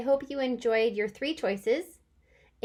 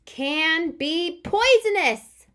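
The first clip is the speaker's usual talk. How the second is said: higher, louder, slow; excited reading; 6 semitones higher, 5 dB louder, much slower than usual. Shown as a demonstration. Teacher rereading the exclamation sentence excitedly. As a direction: read it with excitement. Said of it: "I'm kind of excited about that".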